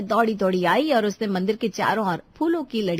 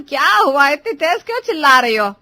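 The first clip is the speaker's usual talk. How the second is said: loud, raised-voice query; alarmed questioning; 3 semitones higher, 11 dB louder than usual. Acted. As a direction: call out to find out the trouble. Elder reacts to the yelling. (ज़ोर से टोकते हुए)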